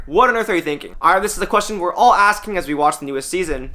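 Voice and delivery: ordinary talk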